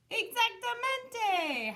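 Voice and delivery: high, loud, slow howl. A narrator's reading. The doggies answering the kitty boy, howling. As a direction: stretch it out like a dog pack. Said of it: "howled the doggies".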